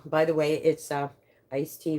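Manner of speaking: ordinary delivery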